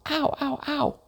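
high mock yelps of pain. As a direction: yelp high as the trout bites. Trout seizes Jeremy.

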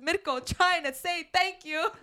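high, slow mocking boyfriend voice; mock Mitko. Teasing friend, imitating boyfriend's awkward thanks, high and slow. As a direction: imitate him fumbling a thank you, up high.

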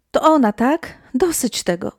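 high, agitated narrated cry. A narrator's reading. Panicked mother cries out at the unseen spirit, agitated. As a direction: exclaim it high, a restrained shout. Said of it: "krzyknęła".

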